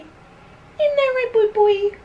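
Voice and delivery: sing-song coo to the pup. Real, not acted. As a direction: owner cooing at her new corgi. Baby talk to the puppy.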